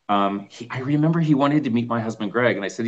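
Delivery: usual speaking voice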